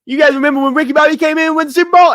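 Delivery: put-on high, rapid-fire Cajun accent; Cajun accent impression. Imitating a Cajun guy for the podcast co-host, Cajun accent. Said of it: "he sounds like that guy who's like".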